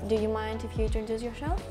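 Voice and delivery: ordinary talk, nothing marked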